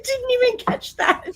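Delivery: very high, caught-out squeak; voice shooting up. Real, not acted. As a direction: let surprise push the voice way up high. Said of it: "did you hear it in my voice?".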